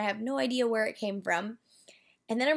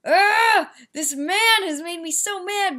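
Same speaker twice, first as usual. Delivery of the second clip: high, loud exasperated outburst; angry ugh; 8 semitones higher, 7 dB louder, slower than usual. Retold or imitated. Retelling the car wash man, a loud angry outburst. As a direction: blurt it out, fed up and furious. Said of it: "So I was like".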